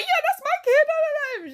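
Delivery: very high, loud gleeful squeal; hammed-up childlike excitement; imitating eager insistence. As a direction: babble it like an overexcited cartoon friend. Blue eagerly insists, acted out.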